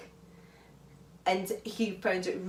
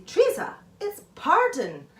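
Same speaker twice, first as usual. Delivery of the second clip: stern mum correcting, higher and louder; 10 semitones higher, 7 dB louder than usual. Retold or imitated. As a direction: play the strict mum correcting her child's word. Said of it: "my mum would say".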